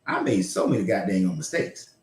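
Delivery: ordinary talk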